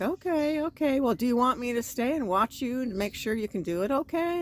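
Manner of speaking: high, eager offer to help; eager young guy. Retold or imitated. Young guy offering to watch her, high eager voice. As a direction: lightly play the eager helper.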